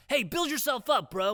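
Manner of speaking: high, loud YouTube hustler pitch; YouTube hype man. Retold or imitated. Parodying YouTube motivators at a sales meeting, hyped. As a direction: pump up the crowd like an online guru. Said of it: "picture the worst, like, YouTube".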